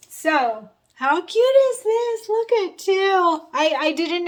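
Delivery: high, loud gush of delight; real delighted excitement. Real, not acted. Vlogger showing viewers her surprise gifts, delighted excitement. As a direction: gush over it, pitch leaping up.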